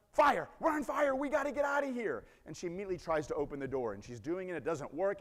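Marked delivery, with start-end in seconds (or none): 0.1-2.2 higher, much louder terrified yelling; panicked screaming. Retold or imitated. Wife in burning bus yelling to husband, panicked screaming. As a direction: scream to get everyone out now.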